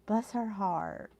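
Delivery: "Bless her heart." drawn out slow and soft, falling on "Bless"; soft and slow, sympathetic, leaning on "Bless"; slow knowing "bless her heart". Shown as a demonstration. Repeating what a friend typed in the chat, slow and soft, stressing "Bless". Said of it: "She says, "Bless her heart".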